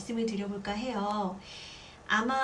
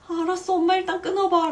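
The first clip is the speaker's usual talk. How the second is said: much higher, louder, impatient brush-off; exasperated cutoff; 8 semitones higher, 9 dB louder than usual. Retold or imitated. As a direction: cut her mother short, fed up. Cutting mom off.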